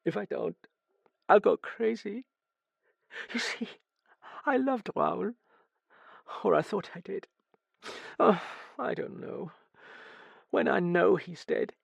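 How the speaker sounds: high-pitched, halting confession; tearful. A narrator's reading. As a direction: pour it out brokenly, close to tears. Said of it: "her face growing half ugly with tears".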